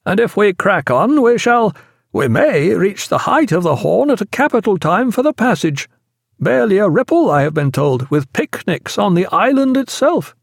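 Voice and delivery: a hint of an upbeat sea officer; lightly voiced shipmate.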